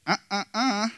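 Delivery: high-pitched, exasperated "uh, uh, uh"; mock frustrated grunts. Preacher mimicking Martha's frustration with high mock grunts. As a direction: imitate Martha's huffy noises, pitched well up. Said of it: "hear the frustration in Martha's voice".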